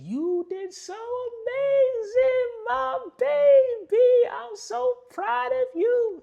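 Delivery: very high, loud, drawn-out gushing; exaggerated high-pitched doting impression, every word stretched; Mama Sherv. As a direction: coo proudly in a slow, piercingly high mama voice. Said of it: "That's how she talks".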